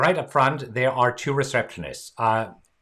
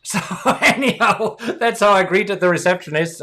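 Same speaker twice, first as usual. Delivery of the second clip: chuckling through the words, a bit louder; laughing; 6 semitones higher, 4 dB louder than usual. Real, not acted. Wrapping up the embarrassing story, laughing. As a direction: laugh at the memory as you wrap up.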